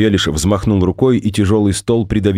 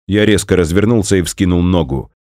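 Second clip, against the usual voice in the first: ordinary delivery, nothing marked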